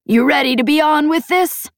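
high, brash alien matriarch; a proud, teasing pirate boss. A narrator's reading. Voiced alien pirate leader Peg.

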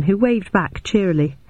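ordinary delivery, nothing marked

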